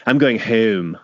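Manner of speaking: mock Philadelphia accent on "home"; hoagie accent. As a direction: exaggerate a Philly hoagie accent, drawing out home. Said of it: "it's that hoagie accent".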